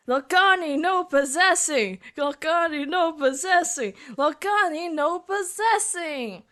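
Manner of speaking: high, pleading character cry; distressed cry. Acted. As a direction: cry it out in dismay.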